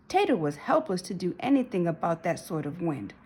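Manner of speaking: usual speaking voice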